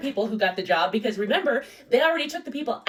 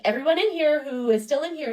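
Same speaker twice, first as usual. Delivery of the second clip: normal talking voice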